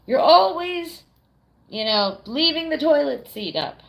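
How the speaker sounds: mock accusing tone. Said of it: "if I ever tell Basti, like".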